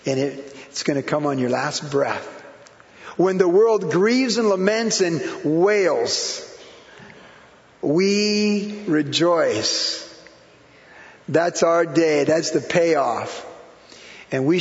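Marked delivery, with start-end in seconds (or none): 3.2-10.1 very slow, drawn-out proclamation; slow preaching emphasis. Real, not acted. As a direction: stretch each word out for the congregation. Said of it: "preacher slows for emphasis".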